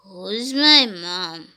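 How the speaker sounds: very low, slow zombie question; play zombie voice. Pretend. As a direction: ask it deep and sluggish. Zombie replies.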